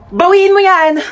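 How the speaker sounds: offended, high-pitched demand; indignant shout. Acted. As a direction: snap back at an insult. Insulted, demands it taken back.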